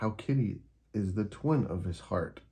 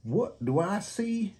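high, slow astonished gecko; gaping surprise; 12 semitones higher, slower than usual. Acted. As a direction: stare in disbelief. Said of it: "Gecko gapes".

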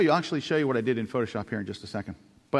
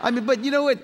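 laughing; 10 semitones higher, 6 dB louder than usual. Real, not acted. Laughing at his own embarrassment.

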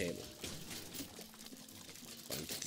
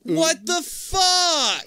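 very high, loud, drawn-out protest; outraged yell; 8 dB louder, much slower than usual. Real, not acted. Player protesting Tyler's move, outraged drawn-out yell. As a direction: stretch the curse into a yell.

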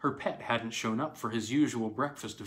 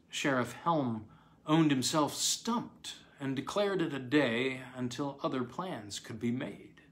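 usual speaking voice

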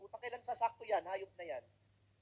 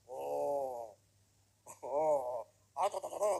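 lower, loud barked syllables in a mock Japanese manner; mock Japanese talk; 4 semitones lower, 6 dB louder, much slower than usual. OFW mimics a Japanese captain on the ship, loud mock Japanese barking. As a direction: imitate Japanese captains sounding like they're quarrelling. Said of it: "Ganun din sila makapag-usap".

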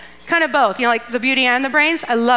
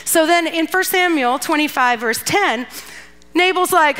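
normal talking voice

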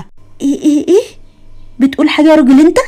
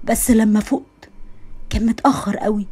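regretful whisper; 3 semitones lower, 8 dB quieter than usual. Acted. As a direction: trail off in quiet regret. Regretful end of addiction story.

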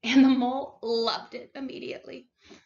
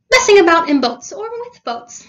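loud, higher-pitched, breezy cheer; jolly and undaunted; cheery; 6 semitones higher, 15 dB louder than usual. A narrator's reading. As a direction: carry on brightly as if nothing happened. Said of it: "went on cheerily".

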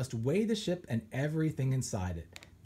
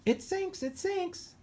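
high squawking parrot crying out; excited parrot. Acted. Parrot excited by sinking foil.

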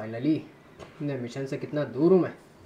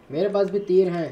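loud boast; 5 semitones higher, 7 dB louder than usual. Real, not acted. Boasting to enemies in fight.

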